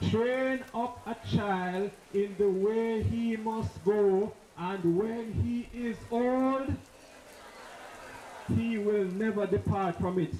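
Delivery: slow, solemn reading of a Bible verse; slow biblical recitation to close the speech; scripture recital. Real, not acted. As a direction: lay the proverb down slowly and gravely. Quoting scripture to the hall.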